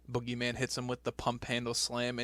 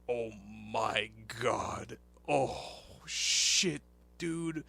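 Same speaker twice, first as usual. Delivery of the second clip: imitated stunned awe, words stretched out; drawn-out, amazed gawking, rising at the end; poggers reaction; much slower than usual. Podcaster imitating Vince's reaction to the dog, stretched-out awe. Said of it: "gave a fucking big ol' poggers face and was like".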